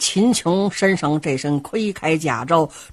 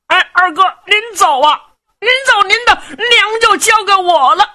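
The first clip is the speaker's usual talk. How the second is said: put-on high, fast, childish voice of a simple young man; simple-minded lad; 12 semitones higher, 4 dB louder, faster than usual. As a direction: blurt it out like a guileless big kid. (傻小子尖声急促地劝娘)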